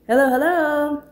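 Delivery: big, booming, friendly hello called from the doorway; friendly loud greeting. Acted. Friend arriving at the party calls out a loud, friendly hello. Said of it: "Mark's friendly voice emanated".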